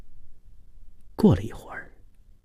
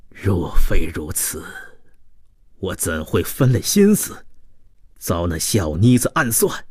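betrayed, vengeful man; 2 semitones higher than usual. Acted. (男子低声质问，渐转暴怒)

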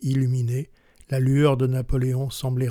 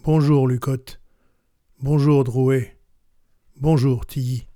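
louder greeting called out of the dark; hailing from the shadows; 4 dB louder than usual. A narrator's reading. Stranger in the dark greeting the three generals, called out louder. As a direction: call each name out firmly from the trees. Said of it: "Une voix sortit de l'ombre".

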